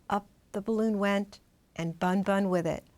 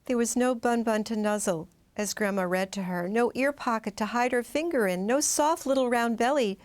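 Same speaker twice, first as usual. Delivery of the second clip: ordinary delivery, nothing marked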